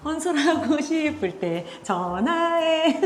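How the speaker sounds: high, drawn-out mock-cheery invitation; tease, on the edge of laughing; playful teasing. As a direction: stretch the last word out playfully, high and loud. Teasing call-me offer, ends laughing.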